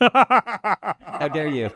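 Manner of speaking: slow, high, drawn-out mock indignation; mock offense. Joking back at the interviewer, slow hammed-up offense. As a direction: ham up being insulted, playfully.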